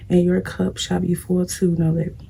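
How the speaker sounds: ordinary talk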